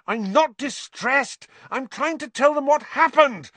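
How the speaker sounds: high, loud, frustrated fury; furious. A narrator's reading. Boy trying to tell what happened, furious. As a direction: protest furiously, voice climbing. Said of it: "Harry said furiously".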